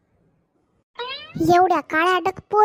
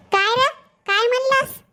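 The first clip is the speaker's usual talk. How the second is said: put-on girl snapping; 6 semitones higher than usual. Girl snaps back at him.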